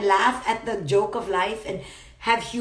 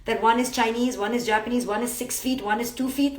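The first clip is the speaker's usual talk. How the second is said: ordinary talk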